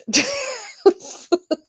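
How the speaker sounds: high-pitched burst of laughter; real giggle. Real, not acted. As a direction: crack up at the memory. Laughing at her fall.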